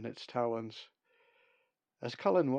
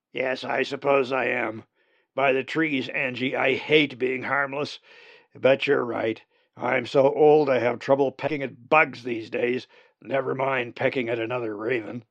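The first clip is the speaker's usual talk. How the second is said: put-on old raven voice; 8 dB louder than usual. Old raven Uriah laments.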